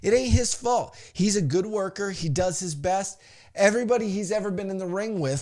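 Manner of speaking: ordinary talk, nothing marked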